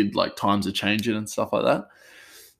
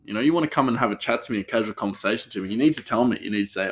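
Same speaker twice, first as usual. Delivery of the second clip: usual speaking voice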